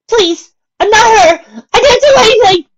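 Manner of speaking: very high, loud, crying plea; tearful pleading. A narrator's reading. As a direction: beg for your life through tears. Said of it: "pleaded to him".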